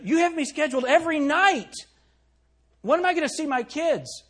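high, loud, upset mother's protest; complaining employee. Retold or imitated. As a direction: whine it up high like a worried mom. Employee complaining to young manager.

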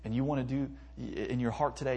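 ordinary talk, nothing marked

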